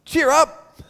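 high-pitched, chirpy encouragement; lightly mocked cheeriness; suddenly friendly crowd. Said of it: "you know what the crowd says?".

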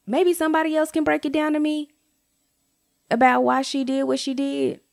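exasperated plea. Real, not acted. Vlogger begging viewers to explain the coworker, exasperated. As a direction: beg the audience for an explanation. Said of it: "I don't understand".

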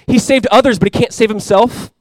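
mocking crowd. Preacher voicing the crowd at the cross, mocking. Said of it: "the crowd is mocking Jesus".

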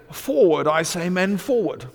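high, rallying British officer's cry; hammed-up British call to the troops; mock British accent. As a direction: imitate a friend mocking a British accent. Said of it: "in a British accent, ripping me off".

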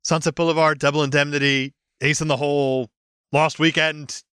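ordinary talk

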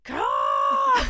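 high, loud barked command; yelling. Retold or imitated. Yelling at the choking woman, a sharp high command. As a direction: yell it to force her to cough. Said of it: "I'm, like, yelling at her".

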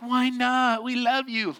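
high, put-on pleading girl voice; wheedling sisters. Sisters coaxing brother who refuses, wheedling. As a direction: whine it sweetly in mock innocence.

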